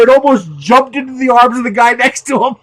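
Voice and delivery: loud, high, on the edge of laughter; gleeful punchline. Real, not acted. Telling how the guy nearly jumped, loud glee. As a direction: push through it before laughter takes over.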